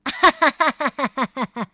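快乐先生开怀大笑; 放声哈哈笑出来; 哈哈大笑. Acted. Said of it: "他哈哈大笑". (快乐先生开心地哈哈大笑)

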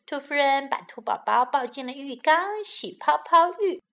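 usual speaking voice

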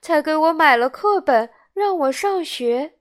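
put-on high child voice making an anxious confession; little boy owning up, imploring; pleading puppet boy. A narrator's reading. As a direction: start in a high outcry, then confess haltingly. Pinocchio tearfully confesses to boss.

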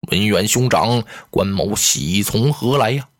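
low, measured general's voice, slowing at the end; a dignified general speaking gravely; low, weighty heroic general; Guan Yu's voice. Acted. Guan Yu replies to Zhang Liao.

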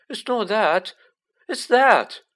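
put-on high, loud, panicked boy; alarmed dread. A narrator's reading. Ron alarmed at red envelope.

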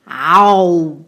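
低沉响亮的恐龙咆哮; 像霸王龙一样吼一声. Acted. 霸王龙示范吼叫. (霸王龙低沉大吼)

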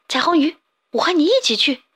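volunteer with a bright call; eager call. Acted. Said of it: "小蓝鱼叫道".

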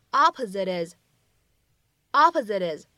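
"opposite is" linked, t flapped like a quick d; flap T linking. Shown as a demonstration. Teacher modelling the American flap in "opposite is". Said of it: "我们可以读成".

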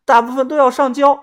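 put-on high, miserable young woman's voice; a caught swindler girl begging; pleading girl. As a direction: wheedle them to let you off. Girl explains earnings.

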